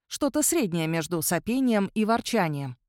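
usual speaking voice

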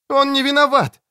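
high-pitched outcry; urgent, high cry in someone's defence; desperate protest. A narrator's reading. Katherine pleads for arrested friend.

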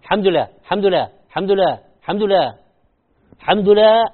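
حمد لا said flatly, no الله, the هاء gone; mock sloppy حمد لا; wrong "حمد لا". Shown as a demonstration. Teacher showing the wrong pronunciation. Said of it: "هذه ما هي الحمد لله".

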